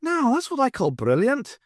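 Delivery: high, loud mock wonder; wonderment. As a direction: gush with put-on amazement. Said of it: "he remarked in wonderment".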